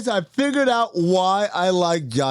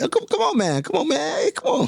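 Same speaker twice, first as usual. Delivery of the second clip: imitating Joshua's hype; 13 semitones higher than usual. Imitating Joshua egging him on.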